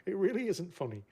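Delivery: real giggles breaking through the words; laughing. Real, not acted. Streamer watching a brutal torpedo hit, laughing. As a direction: laugh while insisting it isn't funny. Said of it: "don't know why I'm laughing".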